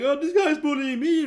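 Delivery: high, loud mock whine of a bully; hammed-up high whine, playing victim; whiny bully. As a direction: squeal it like a bully crying foul. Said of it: "And he's like".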